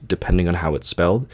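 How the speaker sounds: ordinary delivery, nothing marked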